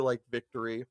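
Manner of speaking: ordinary talk, nothing marked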